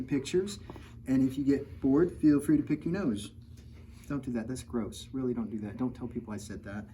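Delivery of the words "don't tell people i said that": usual speaking voice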